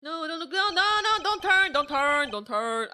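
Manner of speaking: loud, high frantic protest; panicked pleading. Real, not acted. Gamer begging the character not to turn, panicked yelling. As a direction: plead loudly as panic spikes, then easing. Dreading a scare in game.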